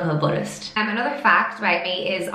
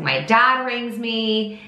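ordinary delivery

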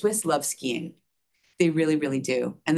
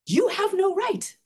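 much higher, slow, disbelieving repeat; incredulous echo; 14 semitones higher, 3 dB louder than usual. Retold or imitated. Echoing the stranger's scolding back in high disbelief. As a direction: throw his words back in shock. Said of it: "I'm like".

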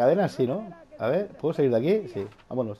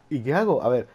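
normal talking voice

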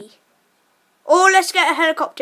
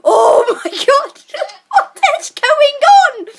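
high, loud, disbelieving laugh through the words; laughing in shock; 11 semitones higher, 8 dB louder than usual. Real, not acted. Crashing down the mountain beside his friend, high loud laughing shock. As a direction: laugh helplessly at the chaos.

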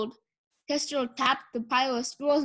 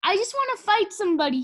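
frustrated outburst; 8 semitones higher, 4 dB louder, faster than usual. A narrator's reading. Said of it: "Clay stamped his feet".

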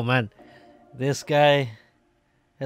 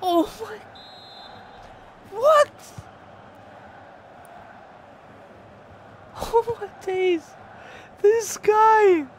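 much higher, squealing disbelief; disbelieving outcry; slower than usual. Real, not acted. Gamer losing to a lucky player, high squealing disbelief. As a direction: shriek at the lucky opponent.

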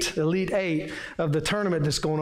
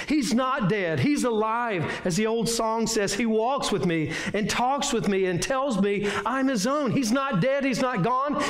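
raised, ringing pulpit voice, building to each claim; fervent preaching; 4 semitones higher than usual. Acted. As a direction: preach it to the congregation with conviction. Preaching on the empty tomb.

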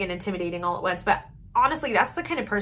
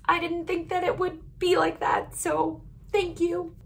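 teary; 8 semitones higher than usual. Real, not acted. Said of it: "I'm done crying".

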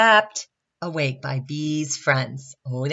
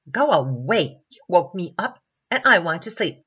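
louder, grumpy protest; grumbling; 4 semitones higher, 6 dB louder than usual. A narrator's reading. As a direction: grumble at being woken up. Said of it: "grumbled Baby Baobab".

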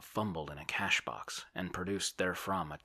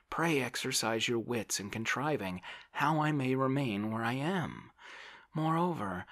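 high, louder, fretful insistence; raised, plaintive character voice; protesting landowner; 7 semitones higher, 5 dB louder than usual. A narrator's reading. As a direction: play a harried man pleading to be left in place. Oblomov protests and pleads.

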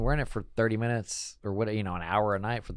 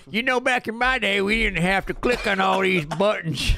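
put-on old man voice; 10 semitones higher, 5 dB louder than usual. Back in my day joke.

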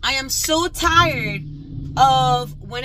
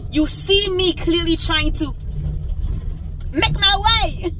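frustrated outburst; 5 semitones higher, 5 dB louder, slower than usual. Real, not acted. Venting at speeding drivers.

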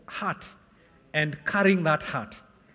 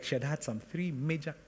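ordinary delivery

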